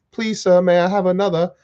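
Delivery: high, meek begging voice; pleading orphan. Acted. Acts a pleading beggar for gruel.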